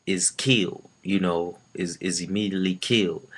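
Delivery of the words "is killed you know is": ordinary delivery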